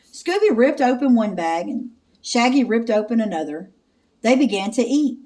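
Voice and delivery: plain reading voice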